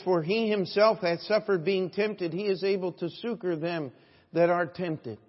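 preached scripture. Acted. Pastor to congregation, reading Hebrews with ringing force. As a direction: declaim the verse with full force.

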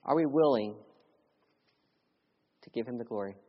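ordinary delivery

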